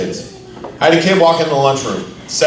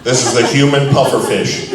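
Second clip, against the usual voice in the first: usual speaking voice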